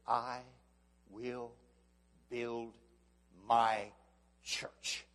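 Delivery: each word set apart with long pauses; slow, word by word. Acted. Preacher spelling out Jesus's promise, slow and weighty. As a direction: weigh every single word.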